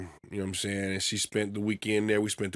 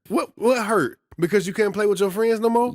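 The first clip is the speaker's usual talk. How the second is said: mock disbelief; 11 semitones higher, 5 dB louder than usual. Real, not acted. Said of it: "mock disbelief".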